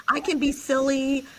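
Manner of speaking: ordinary talk, nothing marked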